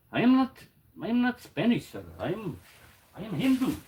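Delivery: lightly put-on Pakistani accent, offended; mock Pakistani accent. Pakistani knife maker answering the greeting, in a mock Pakistani accent. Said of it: "He'd have to be".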